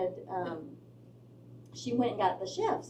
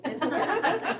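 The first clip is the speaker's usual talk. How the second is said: laughing tease; 8 semitones higher, 4 dB louder, slower than usual. Retold or imitated. Teasing son over his steak.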